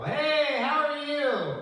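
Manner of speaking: hammed-up cheery hospital greeting; fake cheerful greeting. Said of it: "all of a sudden I go".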